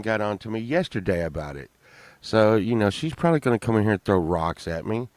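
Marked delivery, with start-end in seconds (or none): none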